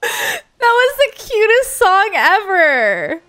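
high, slow, choked-up voice; overwhelmed and teary. Real, not acted. Streamer moved to tears by Lindsay's song, teary and high. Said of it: "I'm, like, crying".

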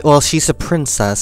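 usual speaking voice

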